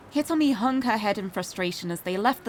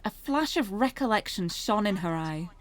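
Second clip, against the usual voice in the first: plain reading voice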